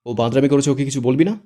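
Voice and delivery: loud, indignant angry protest; angry outburst. Acted. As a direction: shout the complaint at him. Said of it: "শুদ্ধ রেগে বলে".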